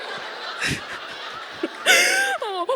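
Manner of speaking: long high sob wail, half laughing; retold keening wail. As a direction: let out a howling sob. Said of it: "I am crying so hard".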